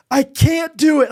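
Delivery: high, loud cry of giving up; desperate cry. Retold or imitated. Wife in labour crying out, high and loud. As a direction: break down at the limit. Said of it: "she's like".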